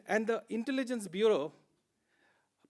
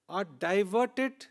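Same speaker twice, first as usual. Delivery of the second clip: diverted said very slowly and pressed hard; stress on diverted; much slower than usual. Shown as a demonstration. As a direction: lean hard on the word diverted, drawn out slowly. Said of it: "Emphasis is on the word diverted".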